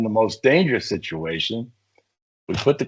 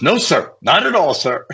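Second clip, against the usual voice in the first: higher, hurried no sir; eager refusal; 8 semitones higher than usual. Retold or imitated. Officer refuses to be lowered down, high eager refusal. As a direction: blurt it out like a nervous recruit.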